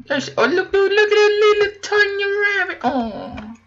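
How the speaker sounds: high, loud cooing at a tiny rabbit; excited squeal. Real, not acted. Player pointing out a tiny rabbit, cooing high and loud. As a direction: coo at it like it's the cutest thing ever.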